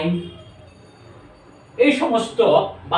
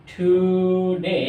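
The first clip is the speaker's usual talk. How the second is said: Today drawn out very slowly, syllable by syllable; slow demo word; 2 semitones higher, much slower than usual. Shown as a demonstration. Teacher to students, showing the syllables of today, very slow. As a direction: stretch today so each syllable is heard. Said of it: "এরপরে যদি today".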